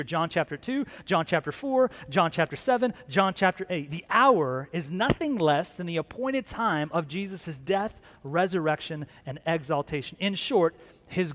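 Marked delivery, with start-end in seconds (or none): none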